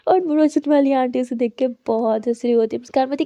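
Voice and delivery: usual speaking voice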